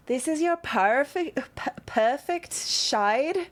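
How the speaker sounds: high, drawn-out Australian drow; Australian accent. Acted. Drow makeup artist to customer, Australian accent. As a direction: lay on a shaky attempt at an Australian accent. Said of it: "Drows have Australian accents".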